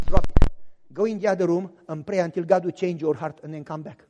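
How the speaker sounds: low, firm order; wife ordering her husband to go pray, low and firm. Retold or imitated. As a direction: settle it with a low, firm command. Wife voiced ordering him.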